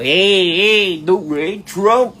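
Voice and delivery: high-pitched mock greeting; mocking imitation. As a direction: imitate an eager hanger-on. Mimics Adam posing beside Trump.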